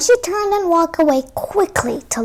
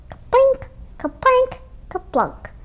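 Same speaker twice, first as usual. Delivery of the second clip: plinking sounds; 11 semitones higher than usual. Acted. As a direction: play the berries dropping. Berries dropping into tin pail.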